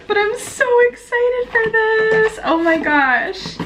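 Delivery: high, drawn-out excited squeal; real excitement. Real, not acted. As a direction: squeal it out, stretching the last words.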